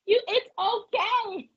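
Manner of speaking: ordinary delivery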